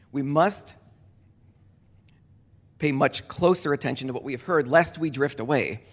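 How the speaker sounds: must held, long pause, then pay much closer attention with closer leaned on; stress on must. Shown as a demonstration. Preacher driving the warning home to the church, pause after must. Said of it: "It's strongly said".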